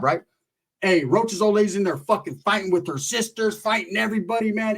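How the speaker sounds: usual speaking voice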